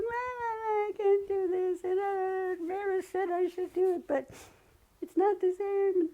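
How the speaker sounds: high, whiny, near-tearful voice; weepy. Retold or imitated. Discouraged at her artwork, whining tearfully to herself. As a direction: whimper like you're about to cry. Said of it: "getting weepy and just going".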